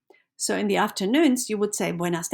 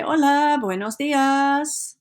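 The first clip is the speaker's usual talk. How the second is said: bright, louder friendly greeting with a slow buenos dias; with expression; 5 semitones higher, 4 dB louder, slower than usual. Shown as a demonstration. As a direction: greet them like you mean it. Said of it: "say it with expression, like you mean it".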